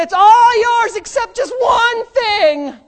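high, loud, slow proclamation; voice of God. Retold or imitated. God telling Adam and Eve, loud and slow. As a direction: declare it like God giving Eden.

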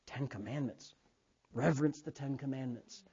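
very soft, low, awed tone; hushed reverence. Acted. Believers thinking of the commandments, hushed and reverent. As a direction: drop to a reverent near-murmur. Acts people's reverent thought.

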